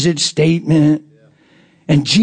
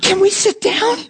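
very high, imitated customer's question; eager request. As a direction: ask in a small high voice, hoping to join.

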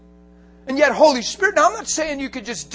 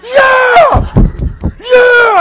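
shouting it out at full force, much higher and louder; flipping out; 12 semitones higher, 13 dB louder, much slower than usual. Retold or imitated. Preacher retelling his joyful run, yelling in the dark. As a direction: let each yeah burst out in wild joy. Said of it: "flipping out".

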